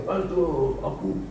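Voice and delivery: tearful retold lament; imitated crying. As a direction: imitate someone starting to cry, fairly softly. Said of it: "nangis-nangis dia".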